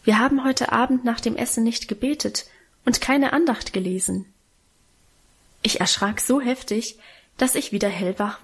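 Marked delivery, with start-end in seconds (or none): none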